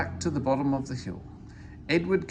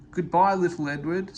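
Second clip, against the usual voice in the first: cheery, louder call of goodbye; 5 semitones higher, 5 dB louder than usual. A narrator's reading. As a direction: sings out goodbye as he leaves. Gordon calls out departing.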